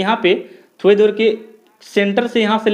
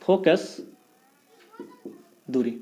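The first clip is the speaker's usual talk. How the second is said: वक्रता and त्रिज्या spoken apart, a long pause between; word by word for note-taking; slow dictation; 2 semitones lower, 7 dB quieter, slower than usual. Shown as a demonstration. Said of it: "तो यहाँ पे लिखना focus".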